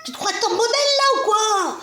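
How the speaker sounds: very high mock child's shout; imitating little nephew. Little nephew teasing his posing aunt, imitated high loud child voice. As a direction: squeal it like a small boy, laughter creeping in. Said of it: "Puis il faisait comme ça".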